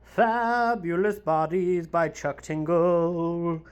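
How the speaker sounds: slow, drawn-out title reveal; slow, showy announcement. Real, not acted. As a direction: stretch each word of the book title.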